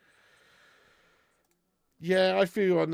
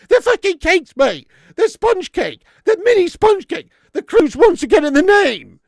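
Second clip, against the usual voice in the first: high, shouted comic indignation; outraged rant; 10 semitones higher, 12 dB louder than usual. Real, not acted. Streamer answering a chatter about Jaffa cakes, shouting in outrage. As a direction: shout the ruling at the chat.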